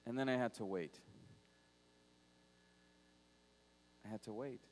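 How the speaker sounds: dropped low and soft; soft, low, lingering repeat; quiet. Real, not acted. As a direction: let the waiting hang, hushed. Pastor slows on waiting.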